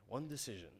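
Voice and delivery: low, hushed trailer narrator; movie trailer voice. Shown as a demonstration. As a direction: intone it like a film trailer. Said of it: "I don't wanna sound like that".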